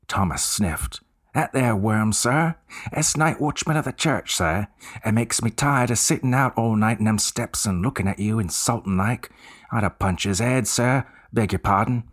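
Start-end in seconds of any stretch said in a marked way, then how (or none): 1.3-12.0 higher Cockney voice of an eager bellboy. Acted. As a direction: play the chatty Cockney servant, keen to help. Cockney bellboy scorns watchman.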